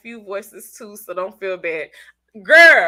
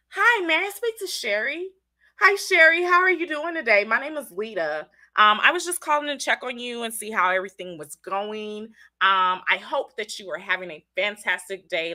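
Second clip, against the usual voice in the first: professional voice. Shown as a demonstration. Host shows viewers her professional phone voice, professional. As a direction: put on your professional customer-call voice. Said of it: "give you my professional voice".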